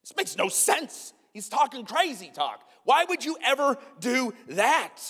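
high, drawn-out incredulous protest; slow, high-pitched exasperated confusion; baffled disbelief. Acted. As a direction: stretch the words out in mock bewilderment.

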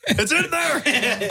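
very slow, very high, laughing; drawn-out high mock dread; laughing mock panic. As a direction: stretch it out in a squeaky laughing panic. Continues the retold panic.

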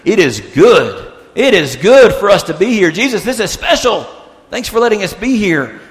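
high, gushing enthusiasm; eager Peter. Retold or imitated. Peter speaking to Jesus on the mountain, thrilled. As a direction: punch it out with delighted emphasis.

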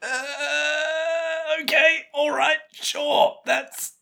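very high, strained reluctant acceptance; sceptical. Real, not acted. Co-host hearing a far-fetched explanation, high-pitched doubt.

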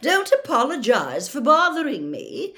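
high, loud indignant sarcasm; irritable call. A narrator's reading. Fat lady left hanging open, calling irritably. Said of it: "called irritably after them".

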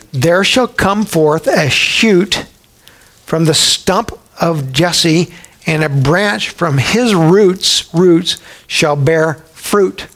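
slow, measured reading of Isaiah; solemn, unhurried Bible reading; scripture reading. Acted. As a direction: draw out each phrase of the prophecy. Reads Isaiah aloud.

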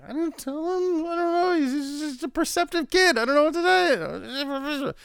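very high, squeaky character voice; hammed-up flustered denial; flustered mock reply. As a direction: babble a defensive excuse up high. Acting out Jurati's unspoken reply.

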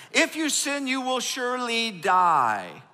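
high, slow solemn warning; slow the words down like a stern decree; voice of God. Retold or imitated. Pastor voicing God's warning to sinners, high and slow.

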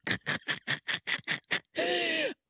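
high wheezing laughter that trails off; long high laugh. Real, not acted. Cracking up over the prom money. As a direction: let the laugh run out high.

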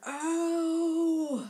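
high loud mock gasp of delight; burn reaction. Host retelling yelling at a burn, high mock gasp. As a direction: yell it like a roast just landed. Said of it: "I was like".

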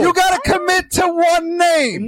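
high, slow shouted demand; exasperated shout. Real, not acted. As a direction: yell it at him, stretched out. Heated naming argument.

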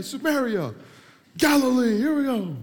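high, loud roll call of place names; mock prayer yelling. Preacher mocking showy prayers, yelling. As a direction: holler each name in mock fervour. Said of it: "You're just yelling the biggest words".

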